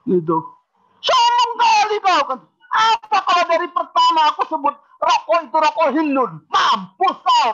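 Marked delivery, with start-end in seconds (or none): 1.0-2.2 angry retort. Retold or imitated. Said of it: "Marah dia". 2.7-7.5 raised shouting voice, slowing on Mampus kau; angry rant. Retold or imitated. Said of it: "Marah dia".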